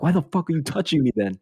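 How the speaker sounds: high freaked-out squeal. Retold or imitated. To Joey after his hand lands on his shoulder, high panicked squeal. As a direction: squeak it like a spooked kid. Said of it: "I'm like".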